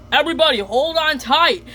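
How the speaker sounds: Mister Grouper warning the class, high and loud. Retold or imitated. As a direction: warn the kids like a cartoon fish teacher. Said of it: "Mister Grouper says".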